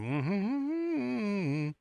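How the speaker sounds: long, high, soft hum; humming vocal warm-up. Retold or imitated. Host imitating Bam warming up her vocal cords, high humming. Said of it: "doing the little humming thing".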